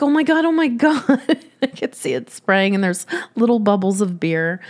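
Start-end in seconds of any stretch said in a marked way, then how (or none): 0.0-1.0 high, rushed panic; reliving the moment of panic; panicked oh my God. Retold or imitated. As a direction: blurt it out in alarm. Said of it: "And so I'm like".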